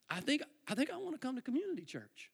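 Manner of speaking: hesitant, soft confession to friends; tentative confiding. Retold or imitated. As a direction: float the idea gently, feeling it out. Tentatively confiding in friends over dinner.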